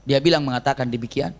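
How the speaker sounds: ordinary talk, nothing marked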